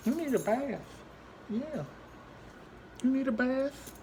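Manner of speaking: very high cooing pet voice. Real, not acted. Owner telling the dog about a bath, high baby talk. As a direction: tease the dog in a squeaky-high coo.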